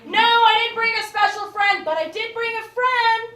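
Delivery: annoyed reply to mother; a touch of annoyance. Retold or imitated. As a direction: push back, irritated.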